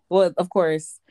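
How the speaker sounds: normal talking voice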